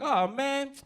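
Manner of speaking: high, loud, fed-up realization; annoyed kid. Retold or imitated. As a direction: roll your eyes at the adult. Cousin's sudden realization.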